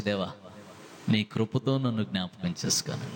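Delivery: humble plea. Retold or imitated. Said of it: "ఎలా అడిగాడు చూడండి". (తగ్గింపుతో మెల్లగా వేడుకోలు)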